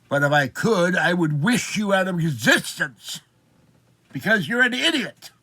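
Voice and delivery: rising anger at a clerk he calls a moron; angry telling-off. Retold or imitated. Retold scolding of the bank clerk, loud rising insult.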